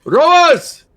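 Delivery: high, loud captain's commands; shouting orders. Acted. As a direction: bellow orders across the ship. Captain calling out to rowers.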